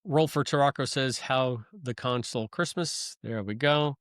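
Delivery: usual speaking voice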